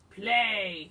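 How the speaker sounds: very loud, drawn-out command; booming storybook bellow; giant's roar. Acted. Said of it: "he roared".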